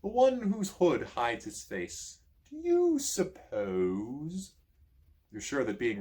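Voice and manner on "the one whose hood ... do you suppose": slow, higher scheming prince voice; Prince John, suspicious. A narrator's reading. Prince John eyeing the hooded stranger, slow and suspicious. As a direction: draw it out, narrowing on the hooded man. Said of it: "says Prince John".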